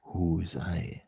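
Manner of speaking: soft unvoiced whisper; hushed whispered question, turned inward. Shown as a demonstration. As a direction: breathe the question, no voice.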